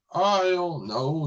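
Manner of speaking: retold shrug. Scientist asked if they are intelligent, shrugging it off. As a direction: stretch it out with a verbal shrug.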